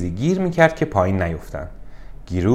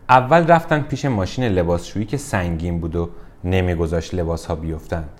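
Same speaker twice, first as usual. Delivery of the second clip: plain reading voice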